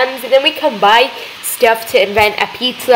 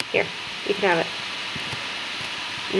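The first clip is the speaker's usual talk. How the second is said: whisper; 3 semitones lower, 14 dB quieter, much slower than usual. Real, not acted. Quiet aside to customer.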